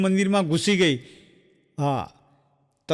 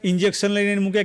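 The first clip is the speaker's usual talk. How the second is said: ordinary delivery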